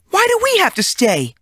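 put-on girl insisting to the teacher they did nothing; girl's protest. A narrator's reading. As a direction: whine it out like an innocent girl.

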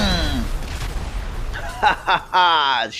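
deep gloating villain chuckle; evil laugh. Acted. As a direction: cackle over the wreck like a monster. Villain after blowing up bike.

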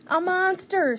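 high, loud cry of alarm; frightened shout. A narrator's reading. Baby spots a snout at the window, shouted alarm. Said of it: "shouted Baby".

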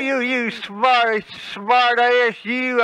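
stuttered comeback; stuttering. Acted. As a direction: trip over the opening word, then repeat the insult. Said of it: "You can't talk without stuttering".

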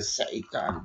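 low, hushed imitation of a growled "Satan"; dark low Satan. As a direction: softly mimic the singer's dark growl on "Satan". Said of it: "y al final dice". (imitación suave y grave de un gruñido)

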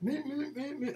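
high nasal meh meh chick calls; woodpecker chick imitation. Shown as a demonstration. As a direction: mimic baby woodpeckers begging. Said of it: "Oh, I've, I've just done it".

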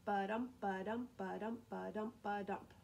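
five even beats of "ba-DUM"; light "ba", stressed "dum", like a steady pulse; iambic rhythm. Shown as a demonstration. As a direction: chant "ba-DUM" evenly, weight on each DUM. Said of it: "so ten syllables".

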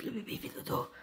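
unvoiced whisper over crib. Real, not acted. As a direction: whisper it very softly. Whispered repetition.

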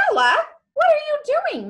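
high, loud, quick exclamation; alarmed mother. A narrator's reading. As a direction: call out in sharp alarm as a mother. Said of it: "Mother said".

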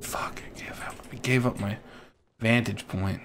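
frustrated unvoiced whisper; hushed annoyed curse. Real, not acted. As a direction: curse it under your breath. Hiding, lost vantage point.

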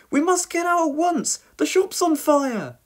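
high-pitched, panicky warning; urgent shouting. A narrator's reading. Dilys to Norman as the shop burns, urgent shouting. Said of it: "shouted Dilys".

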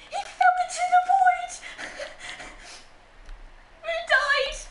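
very high squeal through laughter; helpless laughter. Real, not acted. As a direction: squeak the words out while cracking up. Laughing at player falling into void.

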